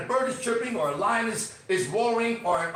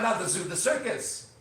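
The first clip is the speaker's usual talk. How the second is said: normal talking voice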